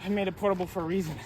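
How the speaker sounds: ordinary talk, nothing marked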